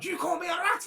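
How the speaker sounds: furious player rounding on a dad; angry confrontation. Retold or imitated. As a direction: demand it sharply at the crowd. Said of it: "Spins round".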